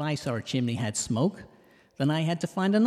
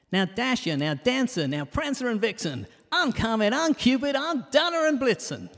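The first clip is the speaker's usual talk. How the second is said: rising excited call, climbing much higher; calling out reindeer; 10 semitones higher, 3 dB louder than usual. Retold or imitated. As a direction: shout the names out, building higher. Shouting reindeer names from sleigh.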